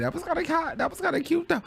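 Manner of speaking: very high, softened squeaky aside; playful gushing. Real, not acted. Commentator admiring the rapper, high playful coo. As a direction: coo it high, half-joking.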